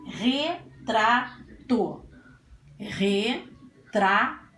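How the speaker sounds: "retrato" slowly split into re, tra, to; syllable by syllable. Shown as a demonstration. Teacher sounding out the word for pupils, "retrato" syllable by syllable. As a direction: give each beat of "retrato" its own pause. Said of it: "Vamos falar a palavrinha".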